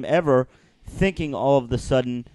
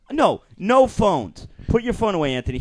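scolding outburst; 6 semitones higher than usual. Real, not acted. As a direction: tell him off sharply. Scolds cohost for phone.

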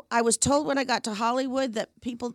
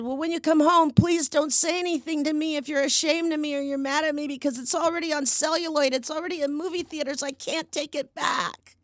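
higher-pitched, anxious appeal; retold pleading; 6 semitones higher than usual. Daughter begging mother not to scold her over film, retold pleading. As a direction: plead it in a raised voice, slowing at the end.

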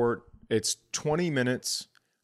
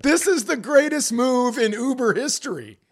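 much higher, louder gleeful admiration; amazed outburst; 12 semitones higher, 5 dB louder than usual. Retold or imitated. Praising the driver's silent trick, high excited cheer. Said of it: "And I was like".

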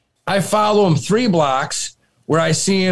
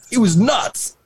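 giggling; 7 semitones higher than usual. Retold or imitated. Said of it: "just starts giggling".

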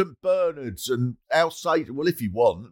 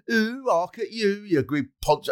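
mocking sneer; 6 semitones higher than usual. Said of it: "two or three people go".

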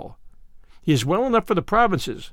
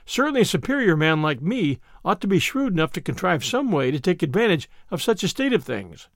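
ordinary delivery, nothing marked

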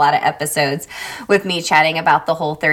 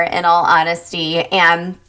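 ordinary talk

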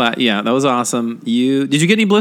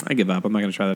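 mock defeated; 5 semitones lower, 6 dB quieter, much faster than usual. Retold resignation about the socks.

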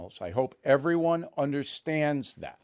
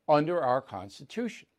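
ordinary talk, nothing marked

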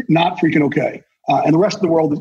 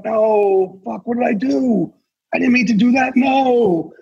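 higher, anguished plea of someone who regrets it; regretful dead person; 5 semitones higher, slower than usual. Retold or imitated. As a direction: beg like a soul seeing the damage it left. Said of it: "looking down at you right now going".

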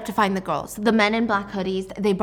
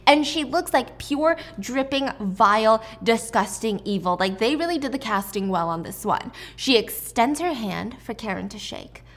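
ordinary delivery

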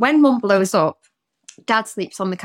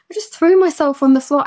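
higher-pitched, upset re-enactment; retold tearful confession; 5 semitones higher, 4 dB louder than usual. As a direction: blurt it out as if mildly tearful. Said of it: "I just burst into tears".

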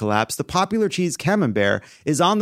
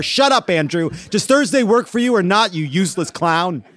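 higher-pitched mock-angry outburst; exasperated scolding; 5 semitones higher than usual. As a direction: tell off the joker in the group chat, fed up. Acted scolding of group-chat joker.

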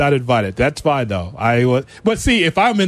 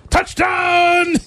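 big high announcer shout; touchdown call; 13 semitones higher than usual. Shown as a demonstration. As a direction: yell it like the play-by-play guy. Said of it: "That's what I want".

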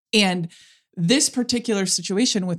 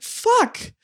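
stunned self-realization; 6 semitones higher than usual. Retold or imitated. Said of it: "and it's like".

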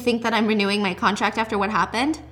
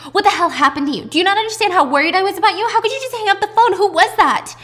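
high, loud, fast scolding; furious teen yelling at her mother; angry daughter; 10 semitones higher, 7 dB louder, faster than usual. Retold or imitated. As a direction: tear into your mom for hanging up.

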